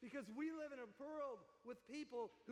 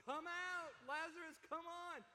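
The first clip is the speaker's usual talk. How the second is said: high, ringing mock summons; leading a crowd in a call; 7 semitones higher than usual. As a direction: call him out of the tomb, pitched way up. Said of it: "calls out in a loud voice".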